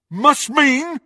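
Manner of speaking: high, loud incredulous echo; ferocious. A narrator's reading. Crabbe turns on Malfoy, ferocious. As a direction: throw the words back fiercely. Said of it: "with undisguised ferocity".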